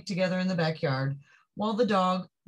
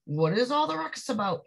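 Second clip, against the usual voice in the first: a hint of a policeman, higher; lightly voiced policeman; 7 semitones higher than usual. Policeman questioning the noisy block, lightly voiced and higher. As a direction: ask what the fuss is about. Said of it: "he asked".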